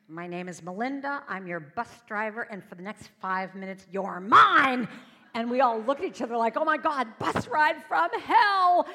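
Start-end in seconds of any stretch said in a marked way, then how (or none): 0.1-4.9 loud announcement building to a huge final shout; domineering bus driver. Retold or imitated. Bus driver addressing captive weary travelers, domineering announcement. 6.6-8.9 high, very loud, drawn-out comic dread; mock horror. Passengers looking at each other on the bus, mock horror. Passengers' horrified shared reaction, acted.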